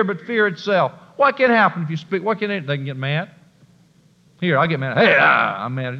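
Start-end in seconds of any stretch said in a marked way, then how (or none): none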